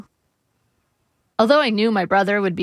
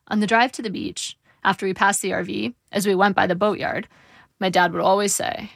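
plain reading voice

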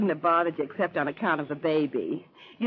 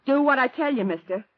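louder, higher hard command; stern threat; 7 semitones higher, 4 dB louder than usual. Acted. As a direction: order him around like a robber. Robber threatens the manager.

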